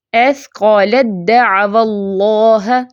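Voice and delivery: recitation with ت merged into د in اَثْقَلَتْ دَعْوَى; tajweed recitation. Shown as a demonstration. Teacher answers how it is read, measured recitation. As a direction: recite اَثْقَلَتْ دَعْوَى اللَّهَ with only the د heard. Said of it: "تو کیسے ہم پڑھتے ہیں".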